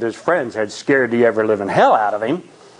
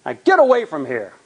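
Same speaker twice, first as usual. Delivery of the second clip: higher, angry yell into the night; 9 semitones higher than usual. A narrator's reading. Johnny yelling at the pranksters outside the car, angry yell. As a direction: drive them off with a shout. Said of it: "Johnny yells".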